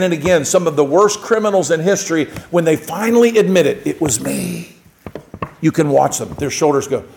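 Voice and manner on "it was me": quiet confession. Retold or imitated. Criminal finally confesses.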